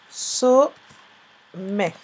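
"Sommet" broken in two, SO... MMET; long pause between the syllables of "Sommet". Shown as a demonstration. Teacher sounds out while writing. (« Sommet » découpé en syllabes)

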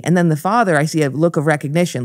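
usual speaking voice

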